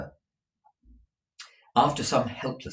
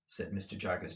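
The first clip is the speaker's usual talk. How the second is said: plain reading voice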